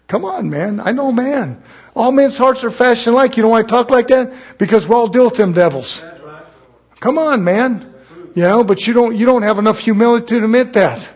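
loud, high fired-up sermon; preaching at full force. Acted. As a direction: preach it hard to the congregation.

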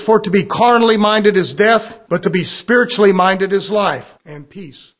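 a touch of preaching force; scripture read aloud. Shown as a demonstration. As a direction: proclaim the verse to the room. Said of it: "Romans eight six again says".

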